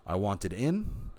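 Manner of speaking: ordinary talk, nothing marked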